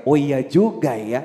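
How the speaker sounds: slow, low musing realization; dawning realization. Retold or imitated. Youngster realizes it concerns them, slow low realization. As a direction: draw it out as it sinks in.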